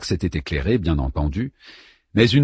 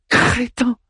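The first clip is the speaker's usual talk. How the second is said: scornful. A narrator's reading. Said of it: "lança Anna".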